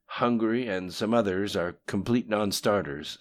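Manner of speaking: reporting underling. A narrator's reading. Lim Shun answers the voice about the invasion, brisk lightly acted report.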